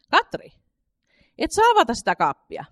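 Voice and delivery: sharp, high, loud call across the kitchen; a mother stopping her child at the cupboard. A narrator's reading. Mother calls Katri away from the cupboard, sharp high call. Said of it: "kun äiti kutsui häntä".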